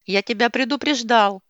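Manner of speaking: loud, furious warning shout. A narrator's reading. Enraged husband warns wife from the kitchen, loud angry. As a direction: snap out the warning in rage. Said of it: "взбешённый муж".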